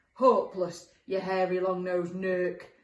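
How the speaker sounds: slow, loud monster jeer; loud scornful character voice at normal pitch; howling monster insult. Acted. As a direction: bellow the insult, stretching the words. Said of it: "howled the second monster".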